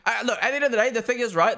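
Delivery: ordinary talk, nothing marked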